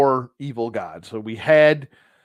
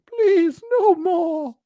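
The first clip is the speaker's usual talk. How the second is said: loud, squeaky falsetto plea; begging wallet; 7 dB louder, much slower than usual. Acted. Wallet pleading with its owner, begging. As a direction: plead in a tiny high voice. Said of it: "begging and pleading with him".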